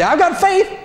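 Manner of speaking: high, bold mock bravado; mock boast. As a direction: toss it off like a cocky fool. Mocking a reckless boast.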